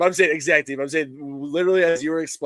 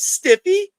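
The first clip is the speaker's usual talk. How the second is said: put-on squeaky, much higher girlish call of a nickname; high girly call; 7 dB louder than usual. Retelling the movie, the woman calls him in a high girly voice. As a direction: call out like a coy high-school girl.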